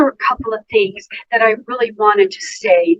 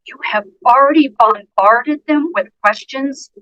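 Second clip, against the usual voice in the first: ordinary delivery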